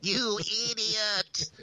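high, slow bleating goat voice. Retold or imitated. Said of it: "like in the goat voice".

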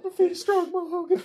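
high silly character voice. Acted.

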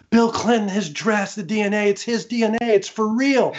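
high-pitched, excited news-breaking; retold excitement. Michael tells CJ about the DNA result, excited. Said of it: "And he's all excited".

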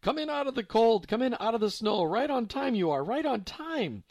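put-on eager old woman's welcome; joyful old German woman. A narrator's reading. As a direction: welcome them in like long-lost family.

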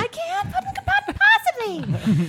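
high, slow squeaky pet voice; animal voice. Acted. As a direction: talk like a tiny animal. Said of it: "That's your, that's your animal voice".

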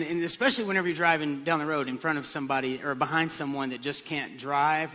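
ordinary talk

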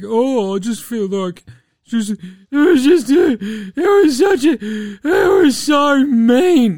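high, loud, whimpering mock sob that slows down; mock the player as a snivelling crybaby; whiny crybaby mock. Host mocking a player who complains, whiny mock sob.

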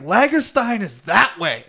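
drawn-out mock pirate announcement; imitated pirate. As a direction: point the way like a pirate leader. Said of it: "talking like pirates".

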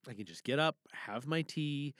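ordinary talk, nothing marked

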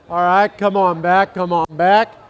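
loud call to a chatting crowd. Real, not acted. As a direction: raise the voice to gather the crowd. Calling the congregation back to order.

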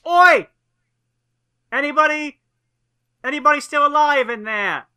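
high, loud shout up to someone out of sight; calling up. Acted. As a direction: hands cupped round the mouth, holler up for survivors. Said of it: "I shout up, like".

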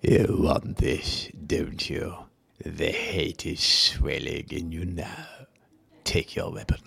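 slow sinister hissing whisper; Emperor voice. Shown as a demonstration. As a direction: tempt him toward the dark side, slowly. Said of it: "Can you do the voice now?".

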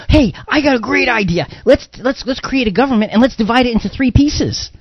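high-pitched, hammed-up eureka voice; mock bright idea. Host mocking an imagined founder's great idea, mock excitement. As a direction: play an imagined founder pitching a silly brainwave.

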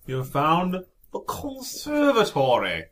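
slow, grand room reveal; dramatic announcement. Acted. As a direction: announce it like a spooky host.

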